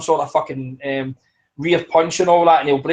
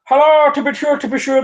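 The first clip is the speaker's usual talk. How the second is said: higher, louder stage-Irish lilt; mock Irish accent; 8 semitones higher, 4 dB louder than usual. Mocking Irish fans in a stage-Irish accent. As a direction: ham up a cartoon Irish accent. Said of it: "they'd be like".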